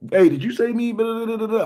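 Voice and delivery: high voiced caller, way up in pitch; playing the other guy on the phone; mock phone caller. Acting out a friend calling to ask what he said, in a high mock voice.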